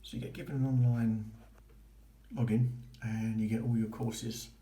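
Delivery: ordinary delivery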